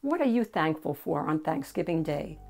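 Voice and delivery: plain reading voice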